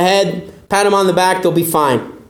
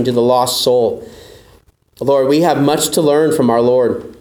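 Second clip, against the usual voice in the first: lower, reverent prayer voice; closing prayer; 6 semitones lower than usual. Acted. A pastor leads the closing prayer in a reverent voice. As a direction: pray humbly over the congregation.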